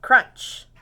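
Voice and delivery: plain reading voice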